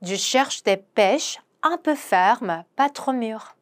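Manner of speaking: very slow, careful French; slow pronunciation model; slow demo. Shown as a demonstration. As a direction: lay out each syllable slowly for learners. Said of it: "한 번 더".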